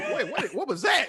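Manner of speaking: high-pitched startled questioning; stammered comic double take; mock startled. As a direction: play suddenly baffled, voice jumping up. Acting startled at a remembered sound.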